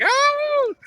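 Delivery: high, sustained ecstatic squeal; hammed-up girlish scream; mock screaming. Imitating the girl watching the dancing, mock scream. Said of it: "the way she's screaming".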